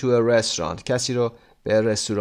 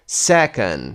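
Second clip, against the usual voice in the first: second with SEC punched, ending dropped; stress on first syllable; 4 dB louder than usual. Shown as a demonstration. Teacher working through the exercise, first-syllable stress on second. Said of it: "first syllable".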